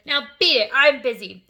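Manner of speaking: loud dismissal. A narrator's reading. Father dismissing Matilda.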